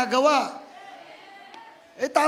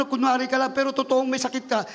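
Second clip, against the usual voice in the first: normal talking voice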